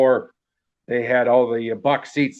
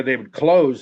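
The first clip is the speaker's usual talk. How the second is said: normal talking voice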